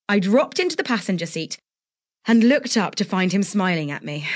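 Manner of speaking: plain reading voice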